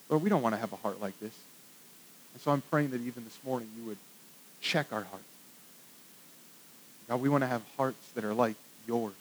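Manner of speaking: soft, hushed pastoral prayer; a quiet, earnest prayer. Acted. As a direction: pray it gently to God for the church. Leads the congregation in prayer.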